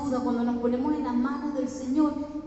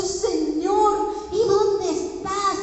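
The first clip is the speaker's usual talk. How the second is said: louder, higher, drawn-out plea; anguished cry to God; 7 semitones higher, 5 dB louder, slower than usual. Acted. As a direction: call out to God in need. Voices believer crying out to God. (clamor angustiado a Dios)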